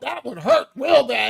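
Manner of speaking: normal talking voice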